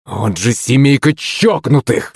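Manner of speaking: high, slow, outraged outburst; exasperated cry. A narrator's reading. Prince insults family before hanging up, exasperated cry. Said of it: "вскрикнул князь".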